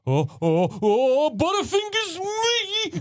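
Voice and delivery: squeaky high, slow hammed-up fumbling; mock clumsy juror. Mocking Nicholas Hoult's bad acting, squeaky and slow. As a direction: ham up a clumsy oops, high and drawn out. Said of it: "then Nicholas Holt's like".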